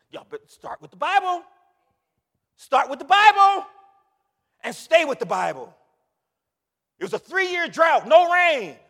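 high, booming pulpit shout; full-force preaching. Real, not acted. Preacher urging congregation to stay with the Bible, shouted. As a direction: preach it loud, stretching the key words.

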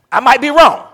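high, loud comic aside; loud mock protest. Shown as a demonstration. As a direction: shout it to the congregation. Loud take of a repeated line.

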